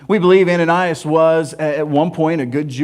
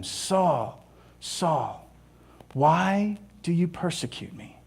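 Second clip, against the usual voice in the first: hushed voice of the Lord; 8 dB quieter, slower than usual. Acted. Voice from heaven speaks to Saul.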